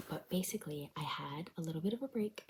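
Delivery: soft whisper, others asleep; barely a whisper. Real, not acted. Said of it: "I'm whispering because people are asleep".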